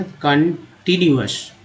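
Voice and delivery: "Continuous" slowly, part by part; टुकड़ों में उच्चारण. Shown as a demonstration. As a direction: stretch "continuous" out, pausing between its parts. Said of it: "सिर्फ टी new पढ़ना था". ("Continuous" को धीरे-धीरे तोड़कर)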